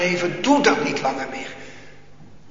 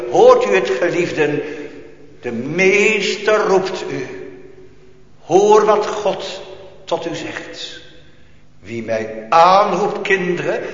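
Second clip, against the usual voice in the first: loud, slowed sermon cry; fervent preaching; 3 semitones higher, 7 dB louder than usual. Acted. Preacher voicing God's call to the congregation, fervent. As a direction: plead with them, full voice.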